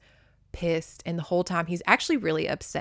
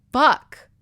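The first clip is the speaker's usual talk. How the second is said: sudden higher, louder outburst; retold frustrated curse; 7 semitones higher, 10 dB louder than usual. Derek upset on his horse, exasperated outburst. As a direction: burst out with one exasperated curse.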